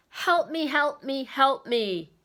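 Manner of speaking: high, loud pleading, stepping down with each repeat; urgent plea. Acted. Speaker quotes a writer's elemental prayer to listeners, urgent plea. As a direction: cry out a desperate little prayer.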